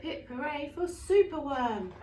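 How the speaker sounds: ordinary delivery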